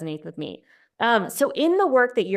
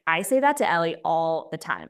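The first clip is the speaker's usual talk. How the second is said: ordinary talk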